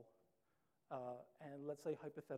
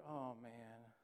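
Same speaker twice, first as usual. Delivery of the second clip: low, softer, dreading voice; 3 semitones lower, 7 dB quieter than usual. Retold or imitated. Child alone in room, fearing mom's wrath, softly dreading. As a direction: mutter it, sure of punishment. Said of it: "You're sitting there like".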